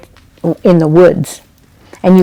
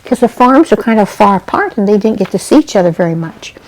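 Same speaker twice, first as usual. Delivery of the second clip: plain reading voice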